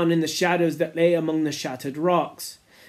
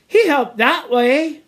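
Simon's voice; 8 semitones higher, 5 dB louder, slower than usual. Acted. Simon defending Piggy.

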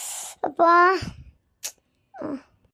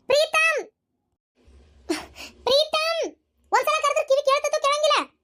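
loud impatient calling; 9 semitones higher than usual. Acted. Woman calling Preetam who won't answer, impatient yell. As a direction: call him sharply from another room, pitched high. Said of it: "ಯಾಕ್ ಅಷ್ಟೊಂದ್ ಜೋರ್ ಮಾಡಿ ಕರೆಯಕತ್ತೀಯಲ್ಲ?".

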